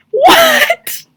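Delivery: high, loud, laughing shriek; shrieking laughter. Real, not acted. Reacting to the villain to viewers, shrieking with laughter. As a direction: squeal in disbelief through laughter.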